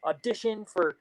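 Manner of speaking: mock pep talk, upbeat and pushing; motivated. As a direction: talk her into chasing dreams. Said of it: "very motivatedly".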